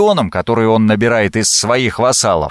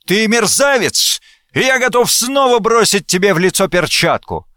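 high-pitched outraged challenge; indignant exclamation; 10 semitones higher than usual. A narrator's reading. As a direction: hurl the insult high and indignant. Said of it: "воскликнул". (возмущённый возглас высоким голосом)

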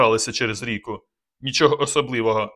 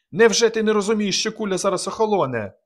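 much higher, urgent pleading yell; desperate shout; 9 semitones higher than usual. A narrator's reading. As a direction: make him understand, fast. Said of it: "крикнув Казик".

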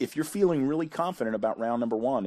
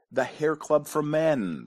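higher, slow, drawn-out reveal; grand proclamation; 4 semitones higher, slower than usual. Pretend. Host revealing the company he founded, grand proclamation. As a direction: proclaim the name like a slogan. Answer proclaimed, then repeated plainly.

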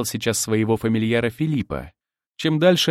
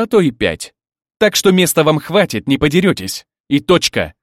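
firm, louder commanding voice; barked orders; 4 semitones higher, 6 dB louder than usual. A narrator's reading. Husband assigning beds to quarrelling brides, firm loud command. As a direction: lay down the rules with raised volume. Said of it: "рявкнул я почти в полный голос".

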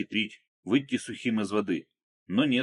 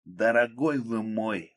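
gushing, drawn-out exclamation; effusive exclamation; 3 semitones higher, much slower than usual. A narrator's reading. Akulov moving to embrace Krot, gushing exclamation. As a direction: exclaim it slowly, stretching the words. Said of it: "воскликнул Акулов".

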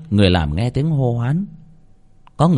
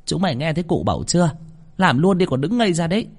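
high, fast, impatient orders; bossy mistress; 7 semitones higher, faster than usual. Acted. Mistress turning to the idle servants, bossy. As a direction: order them about briskly and shrewishly.

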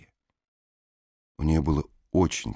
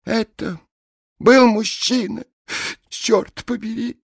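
sentimental blonde woman; 11 dB louder than usual. A narrator's reading. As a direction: pledge your soul to him, high and heavy. Peroxide's sentimental vow.

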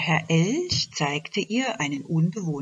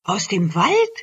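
surprised call; 4 semitones higher, 6 dB louder than usual. Acted. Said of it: "rief eine".

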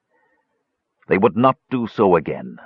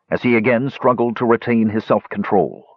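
ordinary delivery

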